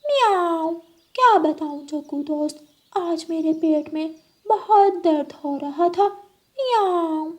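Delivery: high, sweet-talking cat voice with meows; a scheming cat luring her friend; high purring cat voice. Acted.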